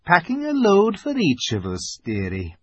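very cool. A narrator's reading. Missus Beaver answers Susan's question, very coolly. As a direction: answer coolly, letting the last word fall. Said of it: "said Missus Beaver very coolly".

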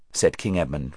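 ordinary delivery, nothing marked

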